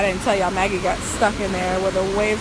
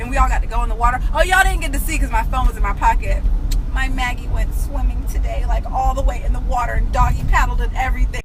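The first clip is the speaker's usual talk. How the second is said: ordinary delivery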